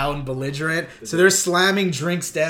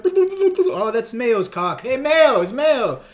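higher, louder, delighted mock call; hammed-up glee at spotting a friend; excited recognition; 8 semitones higher, 4 dB louder, much slower than usual. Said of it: "He's like".

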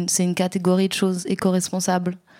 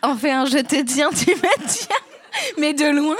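laughing; 7 semitones higher than usual. Real, not acted. Laughing through the game name.

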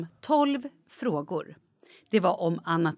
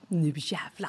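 softer low determined vow; low quiet vow; 5 semitones lower, 7 dB quieter, slower than usual. Retold or imitated. Malin before the bar quiz, low muttered vow. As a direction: mutter it under your breath. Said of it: "Jag bara".

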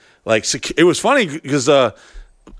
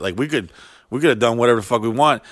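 normal talking voice

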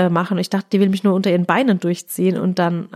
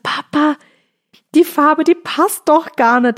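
high, slow, louder protest; whiny complaint; 7 semitones higher, 4 dB louder, slower than usual. Retold or imitated. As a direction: complain to dad in a high whine. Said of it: "Das hab ich gesagt".

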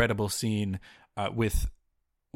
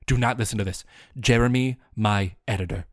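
normal talking voice

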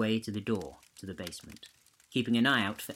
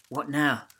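usual speaking voice

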